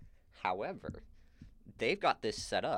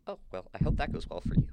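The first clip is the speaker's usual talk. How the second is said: quick, high, louder well-wishing; chirpy retold reply; mock well-wishing; 5 semitones higher, 6 dB louder, faster than usual. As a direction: breezily brush off a threat. Unbothered reply to a death threat.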